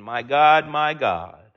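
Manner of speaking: low, grieving lament; low anguished cry. Retold or imitated. Preacher voicing Jesus' cry to God, low and grieving. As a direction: let the words sink low in sorrow.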